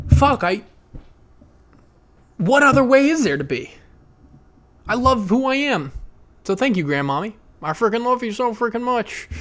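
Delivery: high-pitched, emotional sincerity; heartfelt. Real, not acted. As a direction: pour out thanks to grandmommy from the heart. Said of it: "heartfelt in the end".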